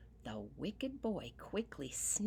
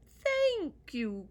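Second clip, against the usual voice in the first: high, loud leprechaun voice; a tiny man crying out thanks; 11 semitones higher, 9 dB louder than usual. Acted. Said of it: "cried the leprechaun".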